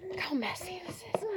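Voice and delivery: high breathy aside whisper. Real, not acted. Muttering to herself about the mess, whispered. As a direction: whisper it off to the side. Aside while setting up reenactment.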